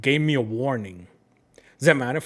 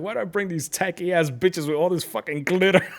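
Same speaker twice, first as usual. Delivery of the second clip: imitating cursing guy; 6 semitones higher, 5 dB louder than usual. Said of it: "cursing up a storm".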